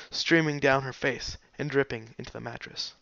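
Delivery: ordinary delivery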